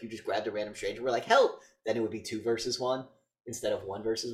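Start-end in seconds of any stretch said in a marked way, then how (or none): 1.2-1.6 short high pretend shout; sudden loud mock scream for rescue; mock cry for help. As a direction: yell it out like calling a stranger. Imagined call to a stranger.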